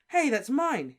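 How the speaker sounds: high and loud; Harry recognizing his wand; startled claim. Acted. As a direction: blurt out in surprise.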